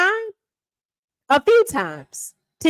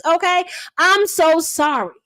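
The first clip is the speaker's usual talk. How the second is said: ordinary talk, nothing marked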